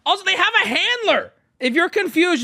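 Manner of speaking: high-pitched incredulous outburst; exasperated exclamation. Real, not acted. Streamer reacting to the post's silly question, exasperated exclamation.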